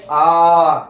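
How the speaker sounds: आ held out long and loud; long vowel. Shown as a demonstration. Teacher showing students the big आ, long vowel. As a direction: stretch आ into a sustained vowel. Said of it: "जिनके उच्चारण में अधिक समय लगे".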